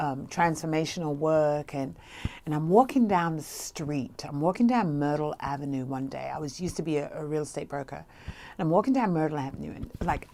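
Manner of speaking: ordinary talk, nothing marked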